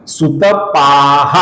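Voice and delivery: सुतपाः drawn out very slowly with long पा and final breath; सुतपाः, slow and clear; correct pronunciation. Shown as a demonstration. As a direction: stretch सुतपाः, long aa and an audible h at the end. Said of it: "ऐसा इसका सही उच्चारण है".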